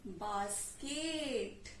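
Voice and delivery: बास्केट stretched into "baas... keeet"; slow modelling. Shown as a demonstration. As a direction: draw out both syllables of बास्केट. Teacher models word slowly.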